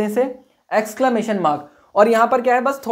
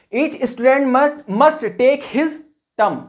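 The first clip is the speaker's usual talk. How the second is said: slow dictation; 4 semitones higher, much slower than usual. Shown as a demonstration. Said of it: "dictating example sentence slowly".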